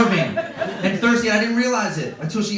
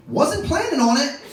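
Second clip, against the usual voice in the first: dry wife voice; 10 semitones higher than usual. Retold or imitated.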